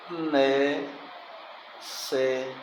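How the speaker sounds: "Né-, se-" as separate, drawn-out syllables. Shown as a demonstration. Teacher starting to split a word for the class, "Né-, se-" slow and separate. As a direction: sound out "Né-, se-" slowly, pausing between them. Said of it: "Sílaba forte".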